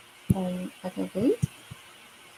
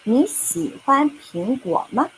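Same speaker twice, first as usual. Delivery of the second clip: 你喜欢苹果吗 asked slowly and clearly, rising at 吗; teacher asking students 你喜欢苹果吗 slowly; slow model question; 5 semitones higher than usual. Shown as a demonstration. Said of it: "I'm going to ask".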